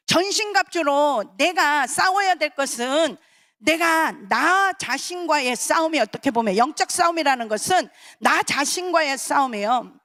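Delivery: ordinary talk, nothing marked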